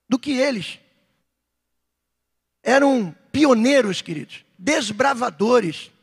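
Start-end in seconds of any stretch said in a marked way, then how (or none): none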